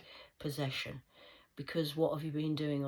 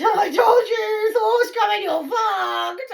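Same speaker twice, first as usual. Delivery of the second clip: much higher, much louder, slow shouted threat; gloating yell; 16 dB louder, much slower than usual. Retold or imitated. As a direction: roar it like a prophecy come true. Retold triumphant threat shouted.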